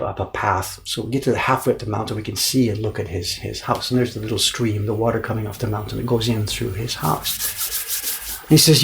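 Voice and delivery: ordinary talk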